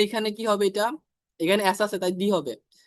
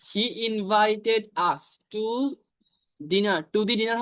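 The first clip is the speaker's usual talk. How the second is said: very slow and deliberate; teacher dictating the example sentence; slow dictation; much slower than usual. Shown as a demonstration. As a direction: spell it out word by word. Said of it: "example sentence".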